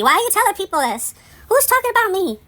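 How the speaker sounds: high, loud indignant questions; rising outrage at the gossip; fed-up high-pitched protest; exasperated. Real, not acted. Exasperated at the rumour.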